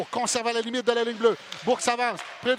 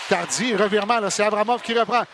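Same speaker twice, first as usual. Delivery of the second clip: ordinary delivery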